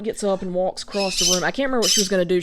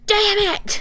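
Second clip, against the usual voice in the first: high-pitched mock outburst; cursing aunt; 13 semitones higher than usual. Imitating aunt tripped by a toddler, high exclaimed curse. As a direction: curse like an aunt who just stumbled. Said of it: "she just full on goes".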